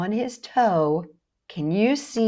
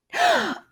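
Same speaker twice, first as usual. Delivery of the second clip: mock gasp. Host reacts to knocked-over table.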